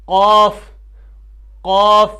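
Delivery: قاف loud, from the tongue root, rounded "qawf"; emphatic قاف struck at the back of the mouth; correct deep articulation. Shown as a demonstration. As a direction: model a heavy, thick قاف. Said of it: "زبان کی جو جڑ ہے".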